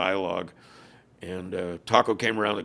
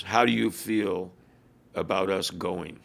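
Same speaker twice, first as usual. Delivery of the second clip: slow, measured, with a pause midway; carefully worded question; slower than usual. Retold or imitated. Rival club leader feeling out a Hells Angel, careful question. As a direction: choose each word with care, slowly. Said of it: "he was very careful how he structured the question".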